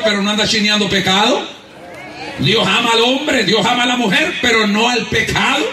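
normal talking voice

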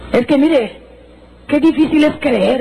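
high, slow, drawn-out exclamation; emphatic preaching. Real, not acted. Preacher to congregation on believing, emphatic and high.

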